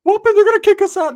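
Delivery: high, loud and fast, protesting; high loud complaining friend; high whiny Ricky. Retold or imitated. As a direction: squeal it like a panicked buddy. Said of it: "Rick said".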